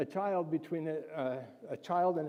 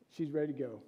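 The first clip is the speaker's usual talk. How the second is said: normal talking voice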